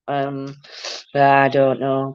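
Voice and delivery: ordinary talk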